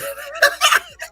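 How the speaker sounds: loud, high-pitched burst of laughter; real high laugh. Real, not acted. Streamer cracking up over her own scent joke, shrieking laugh.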